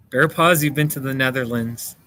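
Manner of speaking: ordinary talk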